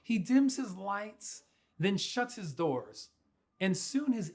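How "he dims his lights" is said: ordinary delivery, nothing marked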